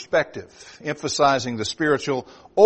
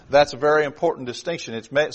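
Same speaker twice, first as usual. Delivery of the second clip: usual speaking voice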